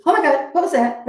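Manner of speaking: high-pitched mock alarm; startled. Shown as a demonstration. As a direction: caught off guard by a sudden fright. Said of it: "butterflies in your stomach can be".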